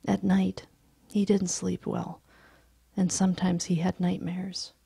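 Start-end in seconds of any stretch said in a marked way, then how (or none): none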